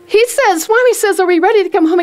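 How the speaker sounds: high, eager retold message; excited relaying. Wife relaying Swami's question to husband, excited. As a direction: pass on the news in high-pitched excitement.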